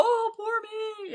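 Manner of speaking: mocking whine of a self-pitying ex. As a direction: mimic the ex playing the victim. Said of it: "Then they're all".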